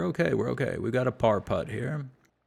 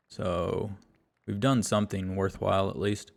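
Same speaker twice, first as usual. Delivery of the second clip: ordinary delivery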